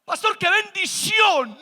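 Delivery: high, loud hail across the room; shouting from afar. Retold or imitated. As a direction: yell to be heard from far off. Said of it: "se escuchó a lo lejos una voz diciendo".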